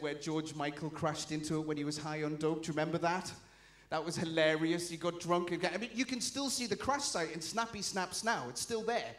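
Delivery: usual speaking voice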